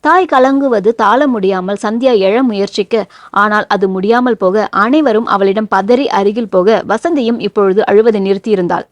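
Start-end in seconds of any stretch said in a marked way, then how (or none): none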